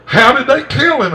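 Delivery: high, loud mock-astonished question; retold astonished question; incredulous demand. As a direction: demand the answer, voice pitched right up. Retold woman demands the answer.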